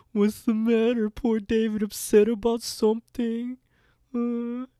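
loud, very high mock concern; jeering, exaggerated pity; Frank. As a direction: taunt with fake sympathy in a put-on high voice. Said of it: "Being a real, a real Frank in that moment".

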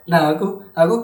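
ordinary talk

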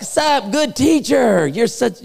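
high, stretched-out hammed-up greeting; butter him up in an exaggerated high drawl; mock flattery. Preacher mimicking the ruler flattering Jesus, mock flattery.

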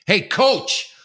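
loud high call across the lobby. Retold or imitated. Angry dad calls out to the coach loudly. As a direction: shout for the coach's attention. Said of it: "I'm sort of loud".

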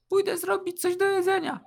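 high, light falsetto for a female character; Kasia's half-voice. A narrator's reading. Kasia to guest about making food, high half-voice. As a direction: speak high, in a put-on woman's voice. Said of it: "powiedziała półgłosem".

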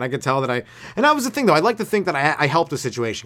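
normal talking voice

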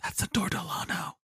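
urgent hushed whisper, breath only; excited whisper. Retold or imitated. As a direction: whisper it like spotting a celebrity. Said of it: "grabs my shoulder and goes".

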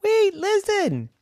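a tiny mouse trying to be heard; Roy the mouse begging to be heard, squeaky high; little mouse pleading. Acted. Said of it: "And he said".

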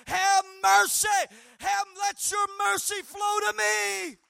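high, loud desperate shout for mercy. Acted. Blind beggar crying out over the crowd, desperate shout. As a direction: shout to be heard, begging with everything. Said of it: "he cried out the more".

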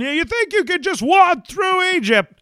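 very high jittery nervous voice; Don Knotts. Acted. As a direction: sputter it, high and panicky. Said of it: "Don Knotts".

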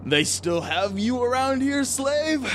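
put-on high, drawn-out old man voice; old man's taunt. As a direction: stretch out the sneering question. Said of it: "this old human".

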